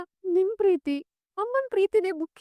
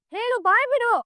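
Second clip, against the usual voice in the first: higher, louder furious demand; angry demand; 7 semitones higher, 5 dB louder than usual. Acted. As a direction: force him to answer now. Daughter demands the truth.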